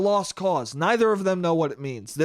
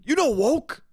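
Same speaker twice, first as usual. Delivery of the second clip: high, slowed, pushy repetition; mock insistent uncle; 6 semitones higher, slower than usual. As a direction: drill the word into them. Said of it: "So he's like".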